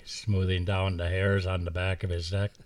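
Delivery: usual speaking voice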